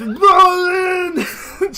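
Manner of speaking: high, loud call of the name; excited cry. Acted. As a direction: yell it in delight. Jimmy cries out again.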